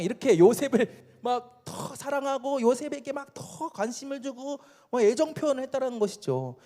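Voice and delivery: ordinary talk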